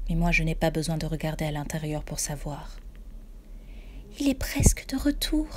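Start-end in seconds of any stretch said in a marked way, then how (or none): 4.2-5.5 high, breathy ghostly murmur; murmured high and hushed like a ghost. A narrator's reading. Ghost mother murmuring to the narrator. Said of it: "murmure-t-elle".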